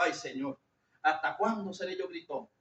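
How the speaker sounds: low, soft plea to God; retold lament. Preacher recalls pleading with God over shouting, quiet lament. Retelling own prayer to God.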